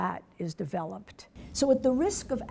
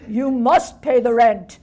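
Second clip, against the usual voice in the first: booming stage villain, much louder; mustache twirling villain; 14 dB louder than usual. Pretend. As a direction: bellow it like a melodrama baddie. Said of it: "this childish parody of melodrama".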